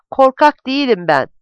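shouted. A narrator's reading. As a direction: call it out loudly, as if jolted awake. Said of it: "diye bağırdı".

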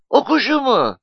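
very high-pitched innocent query; naive, scared question. A narrator's reading. Nuri stalls before furious Mustafa, naive question. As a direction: ask it high, like a guileless child. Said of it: "diye safça sordu Nuri".

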